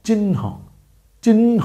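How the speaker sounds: চিহ্ন with a clear ন and a light হ after it; pronunciation demo. Shown as a demonstration. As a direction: model চিহ্ন as "chinho", the হ barely breathed. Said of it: "আগে আছে ন, আর পরে হালকা করে আছে হ". (চিহ্ন: ন স্পষ্ট, তারপর হালকা হ)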